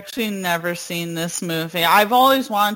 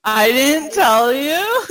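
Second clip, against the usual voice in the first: said slowly through high laughter, breaking into giggles; laughing confession; 9 semitones higher, 4 dB louder than usual. Real, not acted. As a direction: own up while laughing. Admitting the secret purchase.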